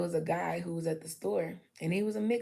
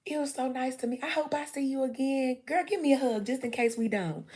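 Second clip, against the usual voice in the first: higher, upbeat chatty voice; to a stranger in the store, bubbly and higher; bubbly friendly intro; 6 semitones higher, 3 dB louder than usual. Pretend. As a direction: charm a new friend into a hug. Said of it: "introducing herself warmly".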